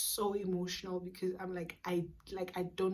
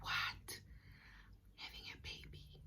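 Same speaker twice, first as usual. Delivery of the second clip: stunned whisper; 13 dB quieter than usual. Retold or imitated. Told she may be in labour.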